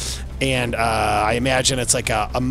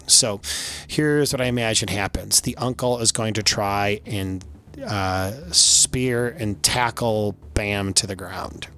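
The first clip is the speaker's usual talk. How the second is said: ordinary delivery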